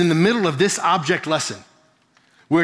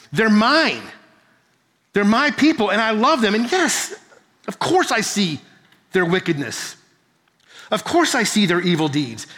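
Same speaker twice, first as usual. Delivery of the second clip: desperate plea; 4 semitones higher than usual. Retold or imitated. God pleading with Jonah over Ninevites.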